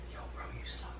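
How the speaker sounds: hushed whisper, barely voiced. Shown as a demonstration. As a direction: whisper it so nobody wakes. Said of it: "so I have to whisper".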